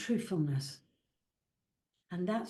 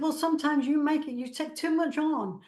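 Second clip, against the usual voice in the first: higher and louder, quickish motherly telling-off; mum's gentle scolding; 6 semitones higher, 5 dB louder than usual. Retold or imitated. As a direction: play mum chiding her daughter kindly. Voicing her late mum's reply.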